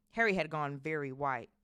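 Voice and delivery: usual speaking voice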